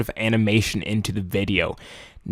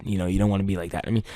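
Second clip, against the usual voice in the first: ordinary talk, nothing marked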